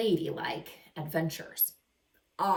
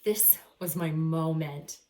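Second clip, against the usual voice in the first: ordinary delivery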